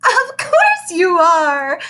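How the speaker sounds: slow, high, sing-song chirp; a syrupy kindergarten teacher cooing; chirpy teacher. Acted. Teacher greets the big new boy, chirpy and high. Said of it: "chirped Missus Fairchild".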